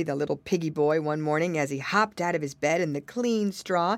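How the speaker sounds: plain reading voice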